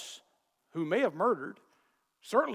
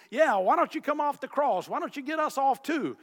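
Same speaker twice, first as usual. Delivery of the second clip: a mild mock jeer, pitched up; mocking thief; 7 semitones higher, 5 dB louder than usual. Preacher voicing a mocking thief, a touch of jeer. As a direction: taunt him lightly from the cross. Thieves mocking Jesus on cross.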